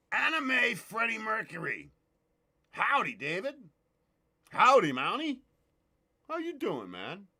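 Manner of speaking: ordinary talk, nothing marked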